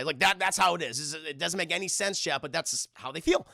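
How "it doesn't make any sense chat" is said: usual speaking voice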